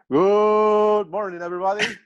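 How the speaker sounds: football announcer. Pretend. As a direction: stretch it out like a stadium radio caller. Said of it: "[name]'s impression of a football announcer".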